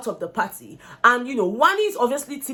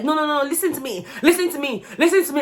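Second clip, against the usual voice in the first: insistent "listen to me"; 4 semitones higher than usual. Retold or imitated. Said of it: "she's the one who is always like".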